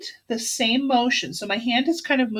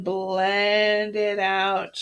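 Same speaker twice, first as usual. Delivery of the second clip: very slow, sustained "blend it out"; drawn-out blending; much slower than usual. Shown as a demonstration. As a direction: stretch "blend" out long and smooth. Drawing out word with pencil stroke.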